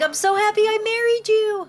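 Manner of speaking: high, gushing lovestruck voice; doting husband. Pretend. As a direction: gush playfully as an adoring husband.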